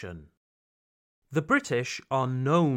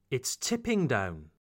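accent lands on "tipping", falling after it; stress on tipping; 3 semitones lower than usual. Shown as a demonstration. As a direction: lean on "tipping", let "down" drop. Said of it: "imitate my intonation".

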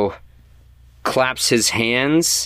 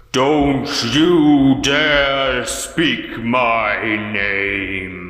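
slow, drawn-out, echoing threat from nowhere; booming echoing voice; 3 semitones higher, much slower than usual. Acted. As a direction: boom it out slowly like a ghost. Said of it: "booming voice echo out".

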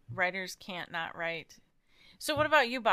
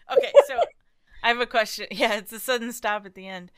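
after laughing hard; 3 semitones higher, 9 dB louder, faster than usual. Real, not acted. Recovering from laughing fit.

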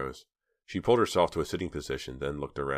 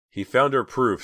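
tearful; 8 semitones higher, 7 dB louder than usual. A narrator's reading. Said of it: "said Dina, still crying".

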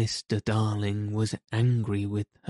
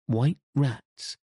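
bright idea blurted out, higher pitch; inspired cry; 4 semitones higher than usual. A narrator's reading.

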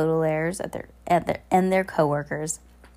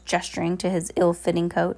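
ordinary delivery, nothing marked

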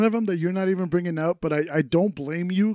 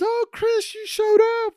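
high-pitched gushing greeting; imitating birthday boy. Birthday boy greeting Chris at the party, high mock squeal. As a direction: squeal it as a delighted host. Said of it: "the birthday boy goes and goes".

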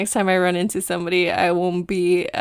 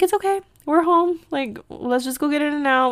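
lightly voiced friend, pitched up high; imitated reassuring friend; 7 semitones higher than usual. Friend comforts her after a chaotic day, imitated reassurance.

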